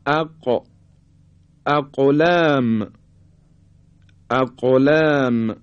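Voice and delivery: "اق، اقلام" with the ق bounced audibly; qalqalah on qaf. Shown as a demonstration. Teacher showing students a vowelless qaf, bouncing the ق in "اقلام". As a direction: recite "اقلام" slowly, releasing the silent ق with a small echo. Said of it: "حرف قاف رو زمانی که ساکن هست دقت کنید".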